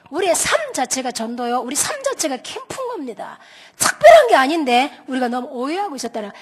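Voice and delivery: usual speaking voice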